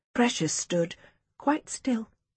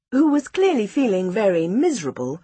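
ordinary delivery

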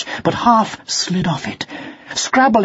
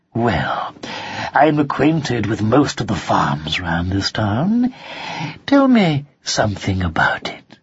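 lower, slow knowing fox; sly fox voice; 4 semitones lower, slower than usual. Acted. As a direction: fox probing with a grin. Fox questions kitten.

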